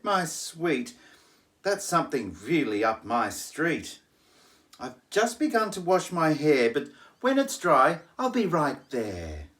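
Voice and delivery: high breezy society lady; posh lady. Acted. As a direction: toss it off sweetly.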